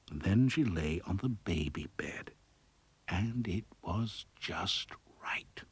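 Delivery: soft whispered narration as she drifts off; hushed whisper. A narrator's reading. Narrator whispering as Goldilocks falls asleep, hushed. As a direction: whisper it like a lullaby.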